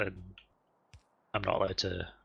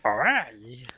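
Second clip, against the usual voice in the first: Jar Jar Binks voice; 3 semitones higher, 6 dB louder than usual. Retold or imitated. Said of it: "saying it like Jar Jar Binks".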